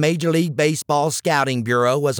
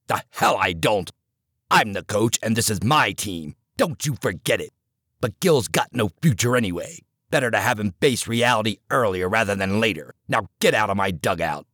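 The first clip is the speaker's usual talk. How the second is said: low, hard coach voice cutting him down; stern older coach; 5 semitones lower than usual. Acted. Coach scolding the assistant in the dugout, low and hard. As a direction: lower the voice and press each jab.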